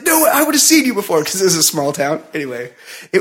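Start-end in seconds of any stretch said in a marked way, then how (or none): 0.0-1.2 high, insistent imitation of a wife arguing; retold high-pitched outburst; mock scream. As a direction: contradict in a mock yell. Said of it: "starts screaming at".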